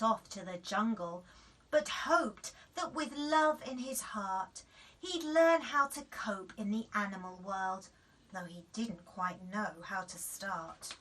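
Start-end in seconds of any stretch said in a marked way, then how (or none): none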